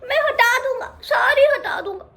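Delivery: high, whiny tearful vow; a hint of sobbing tantrum; mock-tearful cub insisting; crying stubbornly. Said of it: "कह कह के रोता गया".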